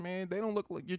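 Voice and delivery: high scoffing quote voice; mocking. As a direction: tease her in a high voice. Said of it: "And I'm like".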